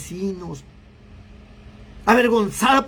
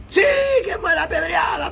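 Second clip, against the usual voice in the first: shouted high like a mob; bay for blood like an angry crowd; crowd shouting; 7 semitones higher than usual. Retold or imitated. The mob crying out to stone the woman, shouting. Crowd demanding her stoning.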